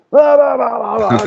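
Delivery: high, loud blah-blah shouting; mock shouting. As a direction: parody two people yelling across a room.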